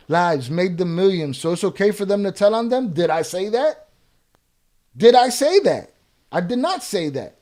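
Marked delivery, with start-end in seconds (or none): 5.0-5.9 louder, higher repeat with a falling end; forceful, pitched-up rhetorical question; indignant challenge. Real, not acted. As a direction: throw the question back hard.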